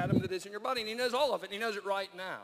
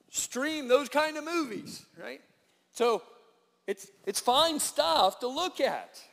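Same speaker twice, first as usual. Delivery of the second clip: ironic aside; 7 semitones higher, 8 dB louder, slower than usual. Real, not acted. Ironic aside about streaming.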